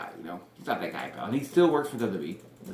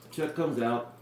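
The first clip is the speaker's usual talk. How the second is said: ordinary delivery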